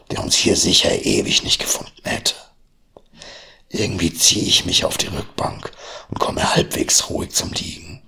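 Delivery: ordinary delivery, nothing marked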